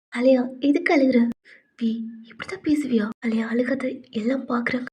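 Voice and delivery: soft voice, fading toward a whisper; quietly calm a crying friend; hushed consoling. Acted. Friend telling crying Alia that people are watching, hushed.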